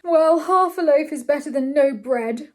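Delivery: loud, gloomy lament. A narrator's reading. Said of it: "in a gloomy voice".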